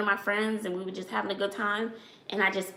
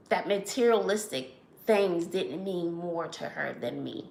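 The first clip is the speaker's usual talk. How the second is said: ordinary talk, nothing marked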